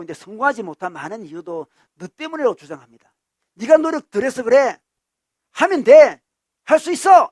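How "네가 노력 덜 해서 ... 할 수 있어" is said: very loud, high-pitched urging; insistent assertion. Acted. Ideologue telling someone to try harder, loud insistence. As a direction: drive each phrase home as a scolding. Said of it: "이렇게 주장하는 사람 있죠".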